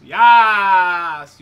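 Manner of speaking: loud, high triumphant yas; excited yell. Real, not acted. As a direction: cheer at the top of your lungs. Excited yelling.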